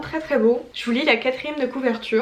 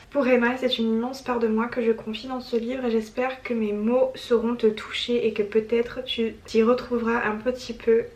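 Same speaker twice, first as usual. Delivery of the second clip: normal talking voice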